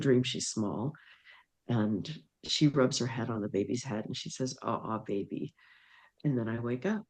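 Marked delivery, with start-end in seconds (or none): 4.6-5.5 soft, hushed cooing to a newborn; tender baby coo. Retold or imitated. Little girl cooing softly to her new baby brother. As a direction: coo gently over the baby. Daughter greets baby in dream.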